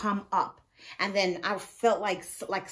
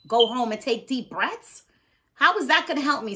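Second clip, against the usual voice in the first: raised, louder indignant questioning; incredulous; 5 semitones higher, 8 dB louder than usual. Retold or imitated. Patient scoffing inwardly at the doctor's advice, incredulous. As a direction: throw the doctor's advice back in disbelief.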